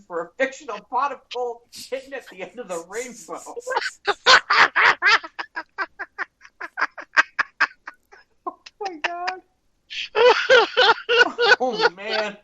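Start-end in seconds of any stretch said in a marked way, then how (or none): 3.5-6.2 high, loud burst of laughter; helpless cackle at the absurd story; loud laughing fit. Real, not acted. Host cracking up at story. 9.9-11.6 high, loud burst of laughter; helpless cackle at the absurd story; loud laughing fit. Real, not acted. Host cracking up at story.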